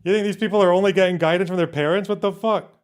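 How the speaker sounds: fast, high-pitched disbelief; incredulous outburst. Real, not acted. Commentator scoffing at talk of parents' guidance, incredulous.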